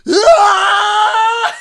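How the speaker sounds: very loud, high screaming battle cry; long mock war-cry scream. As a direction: imitate a furious child charging with a yell. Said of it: "like this war cry".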